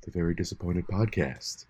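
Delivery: low, hushed NPR host voice; mock serious radio announcer; public radio announcer.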